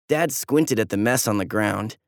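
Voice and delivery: plain reading voice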